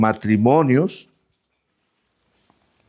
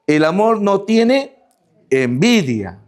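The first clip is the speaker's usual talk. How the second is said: slow raised scripture reading; read slowly; 5 semitones higher, slower than usual. Shown as a demonstration. Preacher reads scripture to the congregation, slow and raised. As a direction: lift and stretch out "tiene" before "envidia". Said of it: "Vamos a leerlo pausadamente".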